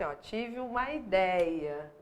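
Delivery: ideia said slowly as i-dé-ia, open é; slow demo. Shown as a demonstration. Teacher reciting the memory phrase slowly to class. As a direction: spell out ideia slowly, no accent. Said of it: "é só pensar assim".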